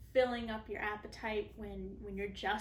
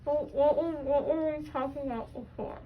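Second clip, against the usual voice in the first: groggy; 4 semitones higher, 9 dB louder than usual. Real, not acted. Woozy patient.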